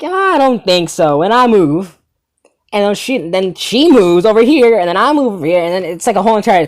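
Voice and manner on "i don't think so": slow, raised-pitch brush-off; higher, dragged-out dismissal; drawn-out refusal. Retold or imitated. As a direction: stretch it out as a pointed refusal. Acted refusal to girl following.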